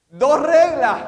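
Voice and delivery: high, loud, declaring two rules; loud emphatic answer. Real, not acted. Preacher answering his own question, loud and high. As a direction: boom it out to the room.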